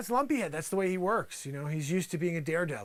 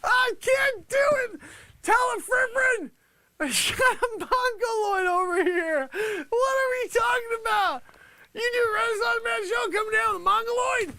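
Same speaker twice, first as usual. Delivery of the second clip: high, loud wail of hilarity; real hysterical high-pitched yelling; losing composure; 5 dB louder than usual. Real, not acted. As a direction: shout it while cracking up, close to tears. Breaks character over Lumpy Head.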